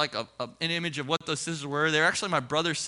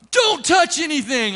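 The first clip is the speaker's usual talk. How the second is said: high, loud, drawn-out command; imitated security yell, slow and forceful; alarmed shout; 9 semitones higher, 7 dB louder, slower than usual. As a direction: yell it like a guard stopping a hand. Retold guard jumping on him.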